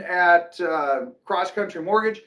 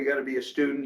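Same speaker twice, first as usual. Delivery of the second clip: normal talking voice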